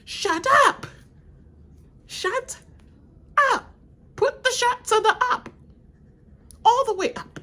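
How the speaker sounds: high, loud mock shut-up; mock bossy shutdown. Shutting Tom up, high and bossy. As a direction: boss him into silence, high. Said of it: "It's like".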